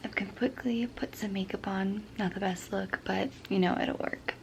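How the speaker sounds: soft early-morning whisper. Real, not acted. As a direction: keep it hushed, house asleep. Still whispering, family asleep.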